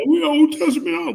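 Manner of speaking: high, fast mimicking voice; mocking these guys. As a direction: rattle it off high and loud, mocking them. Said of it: "you got these guys".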